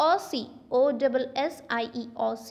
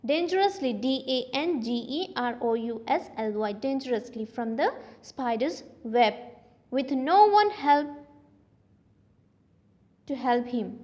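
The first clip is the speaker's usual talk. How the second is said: plain reading voice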